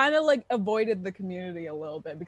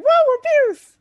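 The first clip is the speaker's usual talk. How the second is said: very high, loud mock whoop; hammed-up gleeful shout; mock cheering; 11 dB louder, slower than usual. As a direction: cheer it like a teasing impression. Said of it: "You were just like".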